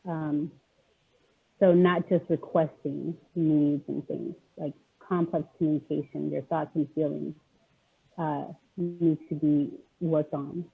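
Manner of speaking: ordinary talk, nothing marked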